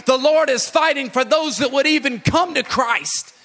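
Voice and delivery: high, loud and driving; preaching at full force. Acted. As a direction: hammer each line home. Building sermon climax.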